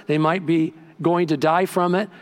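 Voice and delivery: ordinary talk, nothing marked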